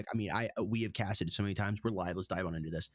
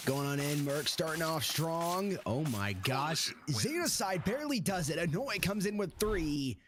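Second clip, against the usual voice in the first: higher, rising live call of the action; hyped esports caster; excited play-by-play; 7 semitones higher, slower than usual. Acted. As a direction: call the fight as it happens, pitch up. Live play-by-play of knife round.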